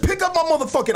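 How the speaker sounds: very high mock yell; retold yelling match. Man retelling his yelling match, high mock yelling. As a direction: push the pitch way up as if yelling back. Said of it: "now I got in a yelling match".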